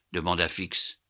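ordinary delivery, nothing marked

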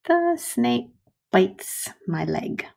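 slow, clear model sentence; example sentence read slowly. Shown as a demonstration. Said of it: "Or I might say".

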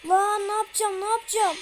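yelling in panic after a jumpscare; panicked yell. Real, not acted. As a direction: shout it in real panic.

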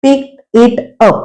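"Picked it up" very slowly, a pause after each word. Shown as a demonstration. Teacher breaks down the phrase for learners, slow word by word. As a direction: break "Picked it up" into three separate words. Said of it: "पिकचा भूतकाळ घेतला ED लावून".

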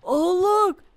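high, loud, drawn-out amazement; amazed exclamation. Pretend.

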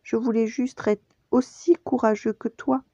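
small high cub voice; contrite young Simba. A narrator's reading. Cub explaining himself to his father, small high voice. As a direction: plead in a high child's voice. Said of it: "dit-il doucement".

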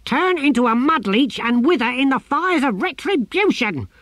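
the tortoise god cursing the gardener; furious tortoise curse. A narrator's reading. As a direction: rain down divine wrath.

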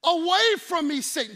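loud firm dismissal; commanding rebuke. Retold or imitated. Jesus rebuking Satan, loud command. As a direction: cast him out. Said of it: "He said".

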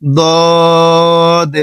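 দদ held long and slow; drawn-out Arabic letter. Shown as a demonstration. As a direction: stretch দদ for about four seconds. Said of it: "এখানে বলতে হবে". (দদ লম্বা টেনে)

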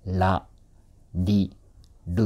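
ラ, リ, ル one by one, tongue tip tapping behind the upper teeth; Japanese L-like ra-sound. Shown as a demonstration. Coach demonstrates the Japanese ra row, ラ, リ, ル each tapped and isolated. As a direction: tap ラ, リ, ル separately off the tooth ridge. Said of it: "奥に触ってから".

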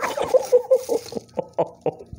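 very high, breathless laugh; high wheezing laugh of disbelief; overjoyed laugh. Real, not acted. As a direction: squeal with laughter, running out of air. Overjoyed at the rare catch.

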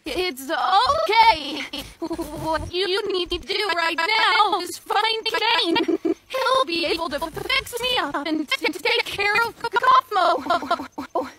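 stuttering digital glitch, syllables echoing and repeating. Acted. Glitched friend tells the other to find Kane, stuttering glitch. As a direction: talk through a broken, skipping voice.

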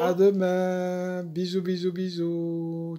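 plus aigu, lent et chantant; au revoir tendre. Real, not acted. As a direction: envoie des bisous aux petits. Mamie dit au revoir aux enfants. (mamie envoie des bisous, tendre et lente)

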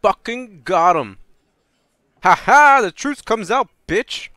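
gloating shout at the caught suspect; triumphant gloating. Real, not acted. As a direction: crow over the win like a sore winner. Player gloats over the suspect.

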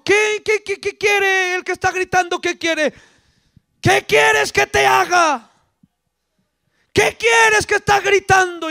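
high, insistent questioning shout; calling out. Acted. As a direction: call back over the noise as Jesus. Jesus calling back to the beggar.

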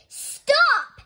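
very high, loud stop; shouted command. A narrator's reading. Mister Rogers interrupts Amelia Bedelia's explanation, shouting. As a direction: cut in with a sharp shout.

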